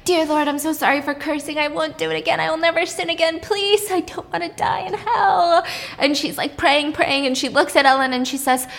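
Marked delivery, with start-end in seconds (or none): 0.0-5.6 high, frantic begging with a broken laugh; pitched-up hammed-up panic, laugh-crying at the end; panicked prayer. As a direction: pray in a flustered panic. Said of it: "she starts panicking".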